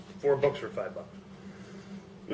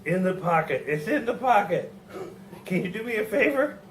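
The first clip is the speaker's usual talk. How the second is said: put-on young person faking old; 7 semitones higher than usual. Beaten thug answering Peggy.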